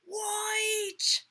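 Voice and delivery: high, loud, drawn-out call; children crying out. A narrator's reading. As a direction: call it out like an excited class. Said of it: "The children cried out".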